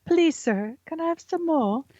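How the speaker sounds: put-on high pleading child voice; Oliver begging. Cohost doing the household Oliver bit, high pleading child. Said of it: "we do it all the time".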